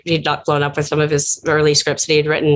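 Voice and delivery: usual speaking voice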